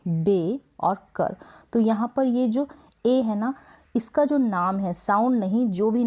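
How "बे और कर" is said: बे और कर very slow, piece by piece; long बे, pause, separate कर; split into syllables. Shown as a demonstration. Teacher repeats the broken word, बे और कर slowly separated. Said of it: "इसको हमने break किया".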